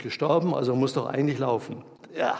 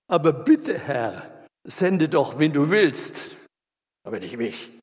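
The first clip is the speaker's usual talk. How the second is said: imploring, bitte pushed up and loud; pleading Mose; 4 dB louder than usual. Acted. Mose refuses God's calling, loudly pleading. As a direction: beg desperately, then drop away on mich.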